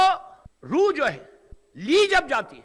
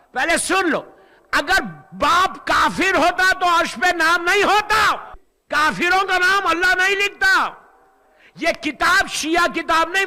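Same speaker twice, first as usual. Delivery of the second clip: full-force preaching; 3 semitones higher, 5 dB louder than usual. Real, not acted. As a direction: hammer the point home to the congregation. (پرزور خطابت)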